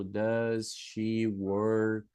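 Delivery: "Does she work?" stretched very slowly, word by word; slow model sentence. Shown as a demonstration. Teacher spells out the question for her class, very slowly. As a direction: drag out "Does she work?" so pupils catch every word. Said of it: "Por exemplo".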